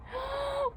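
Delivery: sharp high intake of breath; delighted gasp. Real, not acted. Seeing tattoos again.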